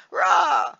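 high mock roar from a jump scare ad; monster roar. Recalling a scary ad, a high mock monster roar. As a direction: imitate the jump-out monster screaming rah. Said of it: "something jumps out at you and goes".

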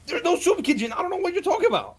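high, loud, rushed denial; panic-yell that it's not true; flustered shouting. Acted.

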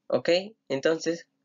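normal talking voice

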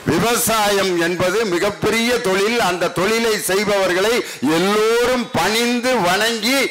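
normal talking voice

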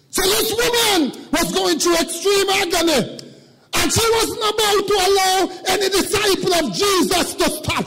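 high, loud, sung-out preaching cadence; preacher rising into a chanted sermon climax; full-force preaching. Acted. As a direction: whoop it up to the congregation.

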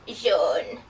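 low witchy voice; witch voice. Shown as a demonstration. Teacher drills class in witch voices, witchy. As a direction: say it like a wicked witch. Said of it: "In our witch voices".

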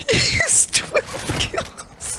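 high, breathless disbelief; amazed squeak. Real, not acted. Amazed at teammate's kills.

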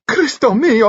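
shrieking, very high panicked denial; scream. A narrator's reading. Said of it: "Dimitri screamed".

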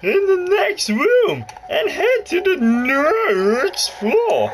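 high put-on voice of the lady game guide. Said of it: "my voice is getting sore".

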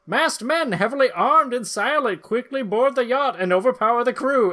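hammed-up caption read; dramatic narration. A narrator's reading. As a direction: read it like a breathless announcer.

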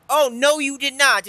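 high, loud protest; loud, high-pitched indignation; indignant outburst. Real, not acted. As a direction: cry out in disbelief at the play. Protesting a play in the game.

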